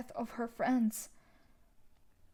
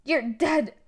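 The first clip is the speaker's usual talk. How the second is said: snarling threat; 3 semitones higher, 8 dB louder than usual. Acted. Villain threatens accuser.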